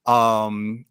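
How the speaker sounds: normal talking voice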